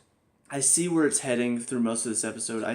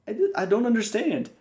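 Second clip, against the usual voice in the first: exasperated bafflement; 8 semitones higher than usual. Real, not acted. Baffled at writers.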